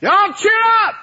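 high, hammed-up holler to the crew; rousing mock exhortation; cheery call. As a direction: call out over wind and waves. Acts preacher calling over the storm.

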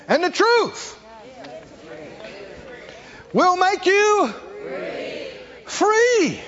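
triumphant preacher's shout on truth and free; full preaching shout. Real, not acted. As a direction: ring it out to the back pew. Preacher's climax on Jesus' words.

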